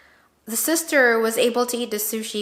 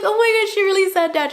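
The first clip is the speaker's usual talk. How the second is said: much higher and louder, fast with laughter; amazed laughing disbelief; 12 semitones higher, 7 dB louder, faster than usual. Retold or imitated. Vlogger retelling her reaction to mom's remark, laughing squeal. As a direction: squeal it in delighted shock.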